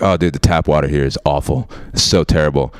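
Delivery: a hint of a whiny younger brother; little brother. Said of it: "He's like".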